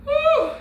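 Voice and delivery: high, loud "woo" to shake off tears; emotional whoop. Real, not acted. Reader catching herself getting emotional mid-reading, high emotional "woo". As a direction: let out a sudden high release of feeling. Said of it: "I'm getting emotional right now".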